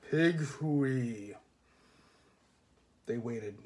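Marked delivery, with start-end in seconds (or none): 0.1-1.3 flat heavy voice. Acted. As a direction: say "Pig hooey" heavy and flat. Figure repeats flatly.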